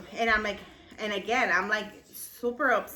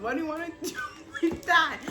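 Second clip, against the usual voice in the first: laughing; 6 semitones higher, faster than usual. Retold or imitated. Quoting sister with laugh.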